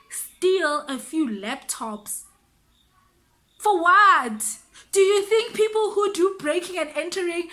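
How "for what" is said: loud, higher, incredulous burst. Real, not acted. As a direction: throw it out loudly as an absurdity. Incredulous rhetorical question.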